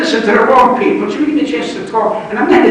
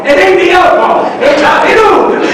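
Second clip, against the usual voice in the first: shouted punchline; 7 semitones higher, 6 dB louder than usual. Retold or imitated. Retold retort, shouted punchline.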